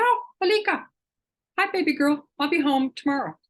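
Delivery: high, affectionate talking-to-your-dog voice; sweet pet talk. Retold or imitated. Owner reassuring her faraway little dog, sweet high pet talk. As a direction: coo it high, as if to a beloved dog.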